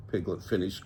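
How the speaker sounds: ordinary delivery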